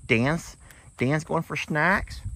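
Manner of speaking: high, slow, curious donkey voice; voicing Beverly the donkey. Owner voicing a donkey noticing Dan heading for snacks, high and curious. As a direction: wonder aloud like a donkey spotting snacks. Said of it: "Beverly like".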